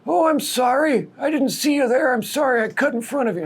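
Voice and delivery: high, thin put-on old man voice; sweetly flustered old man, pitched high; apologetic old man. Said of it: "I was like".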